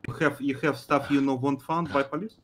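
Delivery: put-on thick foreign accent, clipped broken grammar; broken English accent. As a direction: play a gruff foreign kidnapper with broken English. Broken-English criminal role throughout.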